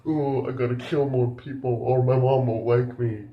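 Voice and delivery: mock-pitiful put-on character voice; whiny tiger. Reviewer mimicking the upset tiger, mock whiny. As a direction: imitate a big sulky momma's boy.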